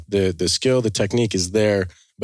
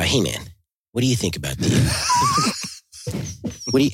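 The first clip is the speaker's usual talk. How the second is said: Evan impression; 4 semitones higher, faster than usual. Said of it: "I haven't heard your Evan".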